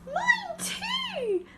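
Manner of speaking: very high squeaky cry; Baby Bear crying about his chair. A narrator's reading. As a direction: squeal it like a tiny cub. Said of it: "Baby Bear cried".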